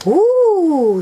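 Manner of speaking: high, drawn-out ooh; loud, high ooh of a curious little pig. A narrator's reading. Peppa curious about a book.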